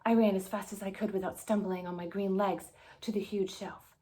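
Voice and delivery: plain reading voice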